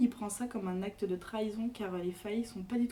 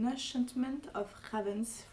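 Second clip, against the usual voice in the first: slow, French-accented English title; clumsy English accent; 2 semitones higher, 4 dB quieter, slower than usual. Real, not acted. Guest reading the English book title, in a clumsy English accent. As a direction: read the English title in shaky schoolbook English. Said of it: "m'excuser pour mon accent anglais un peu merdique".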